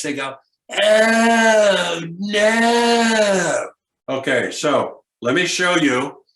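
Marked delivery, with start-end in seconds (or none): 0.8-3.7 loud, high, drawn-out whine of protest; whiny groan.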